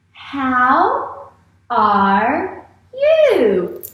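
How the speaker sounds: HOW ARE YOU very slowly, YOU swooping up; questioning. Shown as a demonstration. Teacher models "How are you" for readers, drawn-out questioning. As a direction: stretch each word of "How are you" into a big question.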